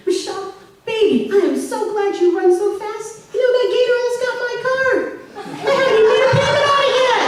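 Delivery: high, fast, sheepish babble; dad excusing himself. Retold or imitated. Dad rushing to excuse himself to his wife, fast sheepish babble. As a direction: talk fast to dodge the blame. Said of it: "And my dad says".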